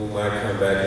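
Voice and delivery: ordinary talk, nothing marked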